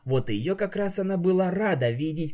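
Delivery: ordinary delivery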